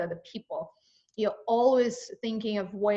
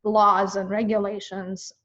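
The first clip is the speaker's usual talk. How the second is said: usual speaking voice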